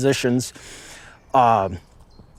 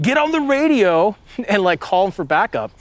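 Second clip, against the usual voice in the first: urgent order; 6 semitones higher than usual. Retold or imitated. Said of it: "They're like".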